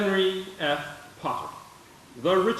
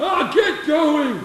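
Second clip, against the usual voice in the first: put-on slow, loud and high, drunk and furious; drunken furious dismissal; angry order; 9 semitones higher, 6 dB louder, much slower than usual. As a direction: shove him out the door with your voice. Boss drives boy out angrily.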